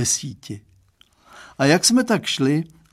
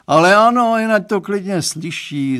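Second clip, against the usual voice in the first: loud, raised-pitch outburst; exclaiming; 7 semitones higher, 7 dB louder than usual. A narrator's reading. Father overriding his daughter's plea, exclaiming. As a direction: call out over the objection. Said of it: "zvolal pan Stránský".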